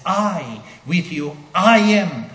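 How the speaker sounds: "I" and "I am" hit hard and high, short pauses; emphatic assertion. Shown as a demonstration. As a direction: stress each piece of "I, with you, I am". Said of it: "an emphatic assertion here".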